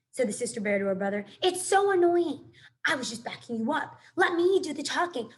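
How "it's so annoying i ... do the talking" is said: annoyed. A narrator's reading. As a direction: snap at the pest of a brother. Sister bear scolds her brother.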